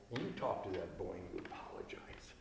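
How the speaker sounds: hushed, unvoiced mom telling him off; whispered scolding. Wife in bed hissing orders at her husband, whispered. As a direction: whisper it sharply so the kid won't hear.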